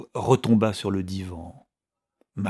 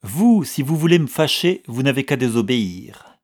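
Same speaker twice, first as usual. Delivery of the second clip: raised, commanding voice; stern order; 4 semitones higher, 6 dB louder than usual. A narrator's reading. Chouchou to Fred, warning him off, sharply raised. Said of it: "lui intima l'ordre".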